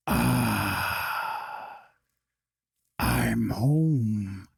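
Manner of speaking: low, satisfied otherworldly voice; deep, eerie relief of a dark presence; sinister book voice. Acted. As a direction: sigh it out like a creature finally set free. The freed book's voice.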